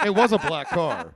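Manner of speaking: laughing through the words; amused laugh mid-story. Real, not acted. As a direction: giggle while telling it.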